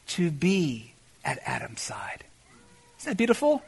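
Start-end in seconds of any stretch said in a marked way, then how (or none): 0.1-2.2 whispered slowly, soft and intimate; tender whisper. Acted. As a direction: breathe it gently like a secret. Tender point in sermon.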